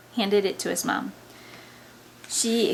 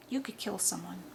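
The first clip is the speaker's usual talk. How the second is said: much softer, ominous; grave hush; 2 semitones lower, 10 dB quieter than usual. Acted. Friend realizing how dangerous the boy is, grave. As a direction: drop to a quiet, serious undertone.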